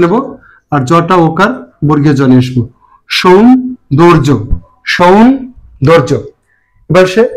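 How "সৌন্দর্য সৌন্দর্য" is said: slow model word. Shown as a demonstration. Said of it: "teacher models word slowly".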